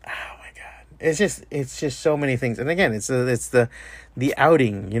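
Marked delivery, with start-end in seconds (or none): 0.1-0.9 breathy exasperated whisper; under the breath, fed up. Real, not acted. Ranting about strangers, whispered exasperation.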